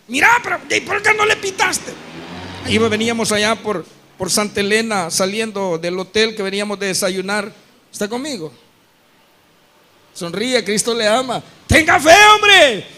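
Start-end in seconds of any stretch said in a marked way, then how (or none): 0.1-1.9 high, pushy mock prod; teasing the father to honk; son egging him on. 11.7-12.9 slow, loud preacher call; fervent preaching shout. Acted. Preacher voice exclaims.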